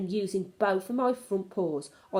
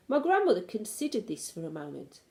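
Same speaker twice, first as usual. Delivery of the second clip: ordinary delivery, nothing marked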